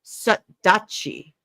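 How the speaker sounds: "Sut dachi" said slowly and deliberately; formal Welsh phrase. Shown as a demonstration. Showing co-host the formal Welsh, "Sut dachi" slow and careful. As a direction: sound out "Sut dachi" in two careful words. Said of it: "if you're using formal Welsh".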